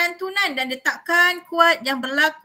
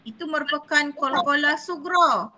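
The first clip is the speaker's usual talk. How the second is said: ordinary talk, nothing marked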